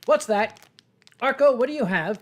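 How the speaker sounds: half-shouted question to someone far off; retold startled call. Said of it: "Drax only said".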